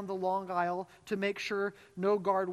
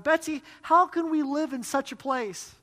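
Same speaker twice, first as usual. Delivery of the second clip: despairing; 7 semitones higher, 7 dB louder than usual. A narrator's reading. Corrie despairing in barracks.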